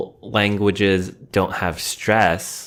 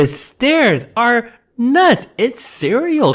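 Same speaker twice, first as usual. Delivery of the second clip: excited; 12 semitones higher, 4 dB louder, slower than usual. Real, not acted. Mocking the intense stare.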